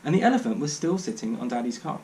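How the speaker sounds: ordinary delivery, nothing marked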